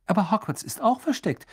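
high-pitched surprised reply. A narrator's reading. Hermine to Ron about hidden Hogwarts, surprised. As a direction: answer as if astonished they don't know. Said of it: "entgegnete Hermine überrascht".

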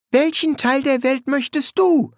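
put-on high-pitched insistent girl's question. A narrator's reading. Pepper demands Adam's share of the world, insistent and high. Pepper presses Adam for answer.

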